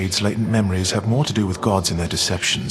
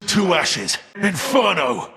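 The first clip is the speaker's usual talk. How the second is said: high, ringing combat commands; a titan unleashing flame in battle; battle cries; 8 semitones higher than usual. Acted. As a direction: call down fire on the foe. Battle commands to his eagle.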